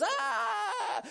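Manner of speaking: high, bursting exclamation; sudden excited cry. Retold or imitated. Peter gets an idea in the storm, sudden high cry. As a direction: blurt out as the idea hits. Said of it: "he goes".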